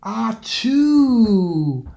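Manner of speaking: sudden hammed-up sneeze; bear sneeze. A narrator's reading. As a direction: let out a bear's achoo sneeze. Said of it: "make the bear".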